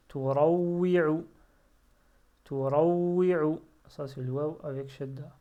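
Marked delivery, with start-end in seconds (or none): 0.1-3.6 Turawwi'u read loud and slow, stretched; slow model reading. Shown as a demonstration. Teacher showing pupils the word slowly and loudly. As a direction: draw out Turawwi'u syllable by syllable. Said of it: "teacher models Arabic reading".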